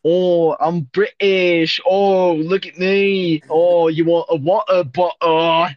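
slow, stretched British caricature; cartoonish British with dropped T's; mock British accent. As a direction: ham up the British, dragging each vowel. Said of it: "heard you do a British accent".

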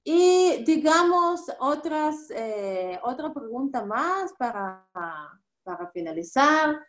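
ordinary talk

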